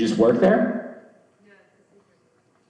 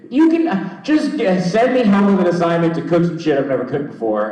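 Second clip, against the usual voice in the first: high exasperated rant; 9 semitones higher, 5 dB louder than usual. Real, not acted. Ranting about college classes.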